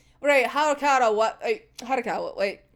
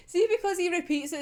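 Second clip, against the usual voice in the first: normal talking voice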